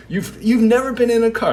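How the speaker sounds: loud, high, quick incredulous question; hammed-up shocked question; mock disbelief. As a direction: play along, acting astounded. [name] plays along with running joke.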